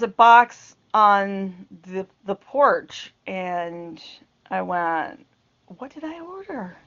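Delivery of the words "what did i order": soft, higher, bewildered; puzzled murmur. Retold or imitated. Puzzled wife finds a mystery box, murmuring. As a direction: wonder aloud at the box.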